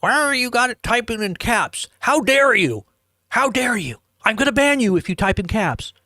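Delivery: high-pitched mock outrage; hammed-up scandalized scolding; upset moderator. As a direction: play an indignant moderator scolding a chatter. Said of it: "I don't know why people get all upset".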